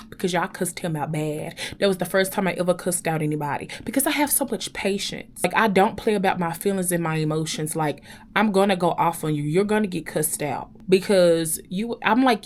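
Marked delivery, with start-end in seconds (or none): none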